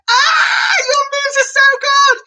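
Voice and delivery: high, loud cheer; excited praise. Acted. Presenter praising the kids' moves, loud high cheer. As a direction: cheer the kids on.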